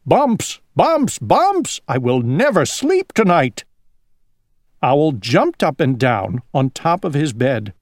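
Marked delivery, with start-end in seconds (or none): 0.1-3.6 rising, high panicked shout. Acted. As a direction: yell it out in a panic. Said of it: "shouted Owl".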